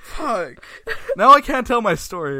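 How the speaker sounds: high, loud, whiny protest; exasperated complaint. Real, not acted. Storyteller complains to friend who derailed the story, loudly exasperated. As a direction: wail that it's all ruined.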